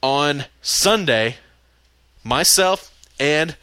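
very slow, spaced-out words; slow right down, one word at a time; deliberately slow. Real, not acted. Host slowing down so he won't talk fast, very slow. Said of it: "So I don't have to talk so fast".

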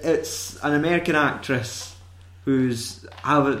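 ordinary delivery

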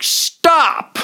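sharp shout, high and loud; a supervisor he would have been, yelling at cops to stop; imagined yell. Retold or imitated. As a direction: yell at the officers to quit. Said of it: "I would have been like".

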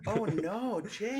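high pitched mock wail; mock dismay. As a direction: whimper sympathetically in fake despair.

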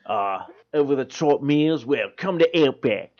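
broad Aussie steakhouse commercial; cheerfully crude Australian pitchman. Acted. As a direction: sell it in an Australian accent. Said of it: "I'm still doing Outback commercials".

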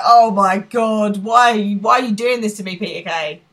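hammed-up heartbroken cry; mock anguished wail. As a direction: wail it at the writer in mock agony. Retelling anguish at the show.

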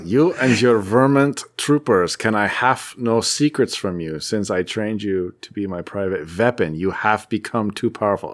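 put-on slow, hammy German accent; German-accented Red Skull. Co-host voicing Red Skull's stilted speech balloons, mock German accent. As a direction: drag it out in a stagey German villain accent. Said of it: "a German accent on the Red Skull".

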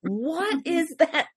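high, incredulous outcry; exasperated disbelief. Real, not acted. Fan appalled by the flat hair, exasperated disbelief. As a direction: push the question up high, dragging out the repeat. Mock outrage over actor's hair.

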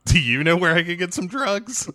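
high-pitched mock-innocent question; hammed-up awkward asking, rising at the end; awkward question back. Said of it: "Which leads to awkward conversations".

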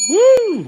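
loud, high-pitched "woo"; sudden high celebratory whoop; excited whoop. Real, not acted. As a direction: cheer them on with a whoop. Host cheers the brand's ambition.

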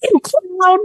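very high, loud final putdown; comeback shout. Acted. Funniest man shouting down the clown, high and loud. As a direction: blast the word clown back at him. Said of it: "he goes".

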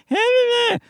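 high-pitched leprechaun squeal; mock leprechaun noise. Shown as a demonstration. Comedian showing how his own voice sounds, leprechaun squeal. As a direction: squeal like a leprechaun with a head cold. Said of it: "Sort of like that".

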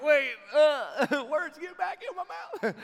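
high-pitched, laughing mock panic; hammed-up frantic regret; comic panic. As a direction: squeal it as if grabbing the words back. Panicked regret at blurted words.

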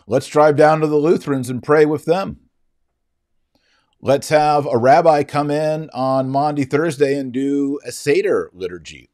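sarcastic mock-cheerful invitation. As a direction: parody the breezy Vatican two mood. Mocking Vatican two openness.

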